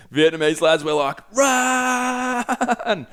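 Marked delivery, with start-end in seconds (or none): 1.3-3.1 very high-pitched cry of alarm; warning the kids to flee the bees, high panicked cry; retold panicked cry. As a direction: yelp it out high as the bees come. Beehive exploded, bees chase.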